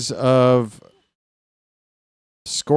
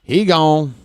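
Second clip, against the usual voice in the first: put-on light take on an old-school broadcaster's home-run call; touch of a louder announcer catchphrase; Hawk Harrelson; 3 semitones higher, 4 dB louder than usual. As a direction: bark it out like a folksy announcer. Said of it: "That's a good Hawk Harrelson impression there".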